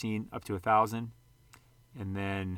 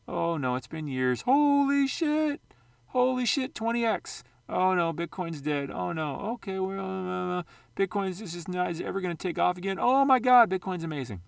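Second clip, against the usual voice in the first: hammed-up investor swinging between panic and joy, much higher; panicky crypto investor; 8 semitones higher, 4 dB louder than usual. Vlogger mocks investors riding Bitcoin's crashes and surges, hammed-up high panic. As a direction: play a frantic investor yelling oh no then oh my God.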